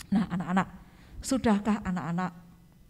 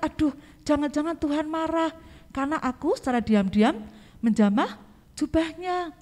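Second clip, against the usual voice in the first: frightened woman; 6 semitones higher than usual. Acted. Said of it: "dia sangat takut".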